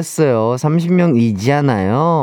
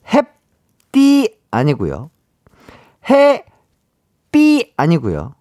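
slow teasing reveal; much slower than usual. Acted. Teasing drawn-out quiz reveal.

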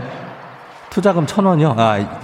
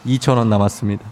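low, hushed ASMR narration; 12 semitones lower, 3 dB quieter than usual. Acted. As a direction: speak low and close like ASMR. Low ASMR travel segment.

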